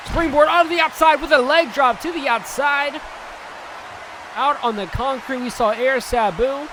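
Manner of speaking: loud, high, fast ringside call; excited play-by-play. Acted. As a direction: call the action at full energy. Live wrestling play-by-play.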